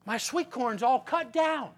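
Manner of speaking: ordinary delivery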